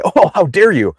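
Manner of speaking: high mock-indignant gasp; hammed-up offended pitch; mock outrage. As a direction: parody an offended listener. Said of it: "They'll just get emotional with me and say".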